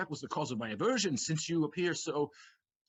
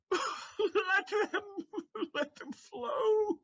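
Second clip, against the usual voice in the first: laughing through tears, high; tears of joy; 13 semitones higher, much slower than usual. Acted. Figaro weeps with joy at his family. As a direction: let the sobs and laughter mix. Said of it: "brought to tears of joy".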